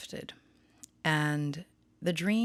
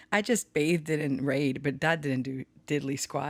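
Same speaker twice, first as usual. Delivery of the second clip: usual speaking voice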